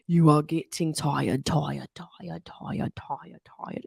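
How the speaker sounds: slow British hypnotist, trailing into a whisper; British accent, echoing and fading out; Glenn Harold. Retold or imitated. Imitating a British hypnotist making her sleepy, British accent fading to whisper. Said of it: "British guy".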